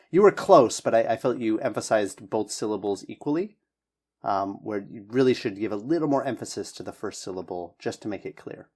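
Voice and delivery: ordinary delivery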